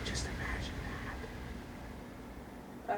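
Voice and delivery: whisper. Real, not acted. Aside, whispered.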